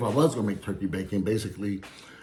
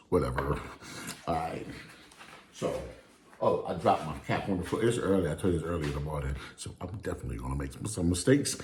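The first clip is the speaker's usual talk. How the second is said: ordinary delivery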